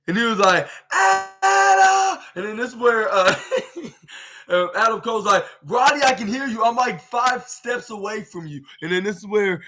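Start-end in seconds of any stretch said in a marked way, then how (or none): 0.9-2.2 very high, loud mock yell; Roderick Strong calling out. Roderick calling to Adam as he comes in, mock high-pitched yell. 5.7-8.6 higher-pitched imitation of an annoyed reply; lightly hammed-up exasperation, pitched up; Adam Cole, mock exasperated. Adam answering Roddy, who stands five steps away, mock exasperated.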